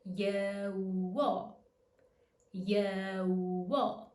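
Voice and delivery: "Ye-wo" drawn out, each glide stretched; slow-motion demo of "Ye-wo", Y to W to O. Shown as a demonstration. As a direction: stretch "Ye-wo" slowly, holding the first sound. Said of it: "Let's take it slower".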